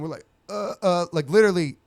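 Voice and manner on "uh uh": high, dumbfounded "uh" noises; mock speechless grunting; stunned wordless stammer. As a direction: play someone too stunned to form words.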